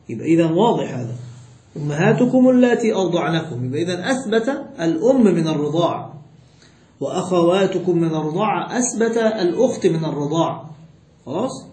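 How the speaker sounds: ordinary talk, nothing marked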